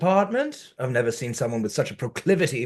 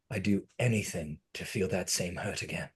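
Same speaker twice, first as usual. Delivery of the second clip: soft; 6 semitones lower, 11 dB quieter than usual. Acted. Alan's longing confession.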